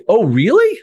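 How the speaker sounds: high, loud "Oh, really?" in surprise; shocked. Retold or imitated. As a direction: sound genuinely shocked. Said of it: "I'm shocked".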